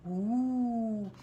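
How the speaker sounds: a drawn-out ghostly ouh; ghost moan. Acted. Reader voices the ghost with a ghostly moan. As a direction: moan like a ghost filling the room. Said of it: "Une voix de fantôme envahit la pièce".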